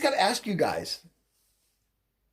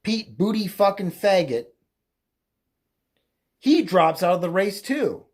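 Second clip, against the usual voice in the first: normal talking voice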